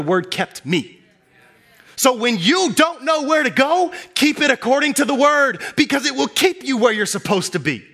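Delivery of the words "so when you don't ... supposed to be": preaching at full force. Acted. Preacher urging the congregation, higher and louder. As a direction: drive the point home to the room.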